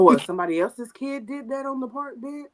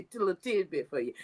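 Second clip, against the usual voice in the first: tossed off fast and softer; quick aside; 7 dB quieter, faster than usual. Real, not acted. Cohost repeating her advice as a fast, softer throwaway. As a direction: rattle it off again under your breath.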